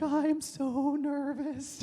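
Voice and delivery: high, slow, drawn-out inner wail; secretly nervous. Retold or imitated. Guy in his own head after denying it, nervous wail. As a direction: play a comic panicked thought, stretched out. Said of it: "And in my head, I'm like".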